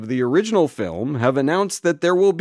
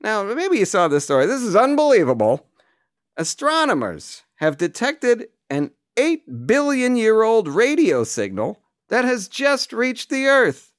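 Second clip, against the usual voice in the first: higher, punchy late-night host voice; Jay Leno impression; 7 semitones higher than usual. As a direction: deliver it like a talk-show monologue. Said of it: "I got a Jay Leno here".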